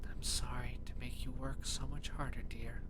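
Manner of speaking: tender whispered mother's voice; a loving mother, breathed like a ghost; whispering ghost mother. Acted. Mother's ghost speaks to hero.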